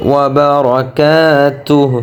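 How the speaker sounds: "وبركاته" with a long held "كا"; one-alif elongation. Shown as a demonstration. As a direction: stretch the aa in "وبركاته". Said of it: "এক আলিফ টান হবে".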